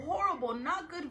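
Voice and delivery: ordinary delivery, nothing marked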